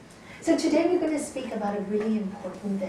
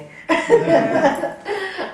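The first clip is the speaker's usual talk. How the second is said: laughing; 8 semitones higher, 10 dB louder than usual. Real, not acted. Teacher laughing while telling the class about her husband. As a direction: burst of real laughter.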